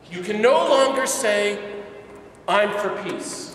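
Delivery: loud, high "You can no longer say"; fiery pulpit warning; impassioned preaching. Retold or imitated. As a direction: call the crowd out. Preacher voices Ellacuría's challenge.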